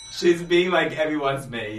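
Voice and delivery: excited. Real, not acted. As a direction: share the gossip with delight. Excitedly sharing a fun fact.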